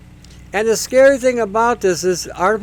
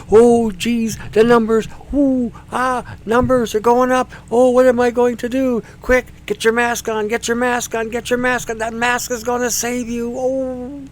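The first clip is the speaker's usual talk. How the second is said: hammed-up panic; 6 semitones higher than usual. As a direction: play a frantic scared citizen. Mock panic about masks.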